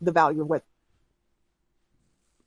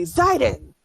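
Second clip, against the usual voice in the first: loud call of a name, pitch up; shouted name; 5 semitones higher, 3 dB louder than usual. Acted. Caravan woman shouts for Zidon, loud call. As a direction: yell the name out to summon him. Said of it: "she shouts loudly".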